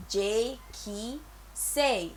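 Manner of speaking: normal talking voice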